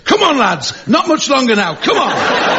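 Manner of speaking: put-on drunk husband. Husband herding spilled snails home, high mock-drunk coaxing. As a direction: cheer the snails along like a tipsy mate. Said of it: "He goes".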